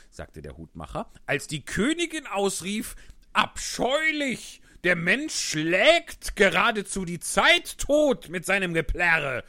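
loud, high, animated Hatter; a dramatic Hatter retelling the queen. Acted. Hatter voiced by narrator. (lauter, aufgeregter Hutmacher)

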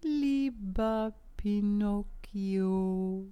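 painfully slow snail, each syllable dragged out; sluggish snail. Acted. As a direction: stretch every word like a lazy snail. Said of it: "antwortete die Schnecke mit ihrer gewohnten Trägheit".

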